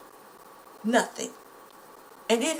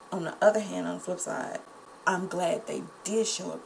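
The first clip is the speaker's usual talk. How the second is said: ordinary talk, nothing marked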